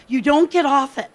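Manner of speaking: ordinary talk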